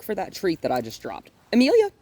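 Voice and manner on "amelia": high, loud name call with rising end; calling the dog. Real, not acted. Trainer summons the dog by name, high rising call. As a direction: call out brightly high to get attention.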